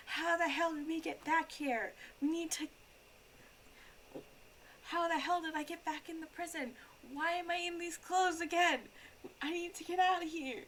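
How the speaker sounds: high, camp mock-German voice; effeminate German voice. Retelling Sky Does Minecraft characters in a high camp German voice. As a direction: ham up a confused brainwashed prisoner. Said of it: "this really effeminate, like, a German, like, voice".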